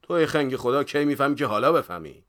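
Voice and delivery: loud, high exasperated telling-off; bitter scolding. A narrator's reading. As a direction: berate a dim pupil sourly. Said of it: "با آق و تلخی گفت".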